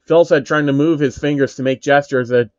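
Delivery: ordinary delivery, nothing marked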